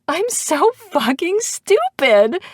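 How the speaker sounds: high, drawn-out hysterical wail; very high, slow, self-mocking hysteria; hysterical laughter. A narrator's reading. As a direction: wail it slowly on the edge of laughing and crying. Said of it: "from laughing so hard".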